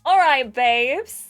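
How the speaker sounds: loud, drawn-out call to the group; addressing the group. A narrator's reading. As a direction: gather everyone up, slow and loud. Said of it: "Chloe addresses the group".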